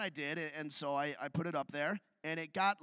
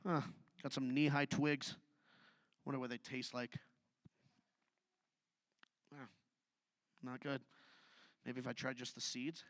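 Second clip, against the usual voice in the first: quiet musing; 7 semitones lower, 9 dB quieter than usual. Acted. First farmer muttering quietly over strange twigs. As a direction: mutter like someone poking at strange plants.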